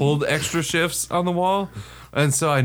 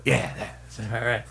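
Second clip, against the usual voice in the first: quiet relieved; 4 semitones lower, 6 dB quieter than usual. Retold or imitated. Relieved hidden reply.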